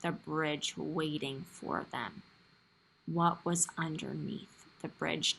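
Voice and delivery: hushed, slow storytelling; suspenseful story reading. A narrator's reading. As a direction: build suspense softly for the children.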